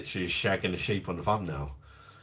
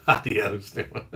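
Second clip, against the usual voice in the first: ordinary delivery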